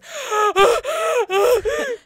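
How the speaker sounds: loud, high, sobbing gasps for air; panicked gasps. Retold or imitated. Retelling the ride's end, imitating loud panicked sobbing gasps. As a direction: reenact gasping, unable to breathe. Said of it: "I can't breathe".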